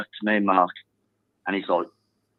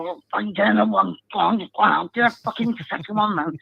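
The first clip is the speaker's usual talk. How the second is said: pitched-up mock terrace yell; retold screaming; 7 semitones higher than usual. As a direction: egg the striker on for another goal, higher. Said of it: "I was screaming at him".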